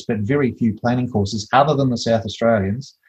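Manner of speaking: normal talking voice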